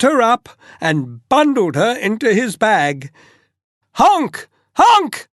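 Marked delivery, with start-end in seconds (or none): 3.9-4.5 high, loud goose honk; screeching goose. Acted. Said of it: "screeched the goose". 4.8-5.3 much higher, louder honk; shriller screeching goose. Acted. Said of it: "screeched the goose".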